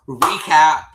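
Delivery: loud, high mock-announcer call; put-on title-card shout; segment announcement.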